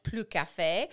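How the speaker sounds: slow "plus café" as ploo kah-FAY; non-native French request, "plus café"; attempted French. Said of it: "went to France and I asked".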